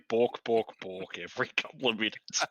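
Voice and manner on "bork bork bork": mock Swedish chef babble; Swedish chef accent. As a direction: bork it like a silly chef. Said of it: "You just gotta say".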